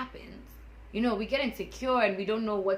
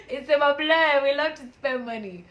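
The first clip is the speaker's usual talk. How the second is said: louder, higher repeat of the line; laughing admission; 8 semitones higher, 13 dB louder than usual. Real, not acted. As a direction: own it with a big laughing push. Repeats after laughing, emphatic.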